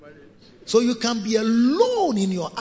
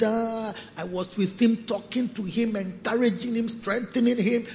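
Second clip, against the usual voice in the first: ordinary delivery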